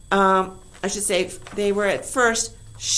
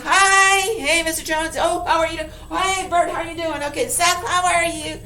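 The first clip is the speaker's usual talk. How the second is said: high, bright-voiced hellos; chirpy hellos to neighbors, acted out; cheerful neighbor greetings; 7 semitones higher than usual. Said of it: "They go like that".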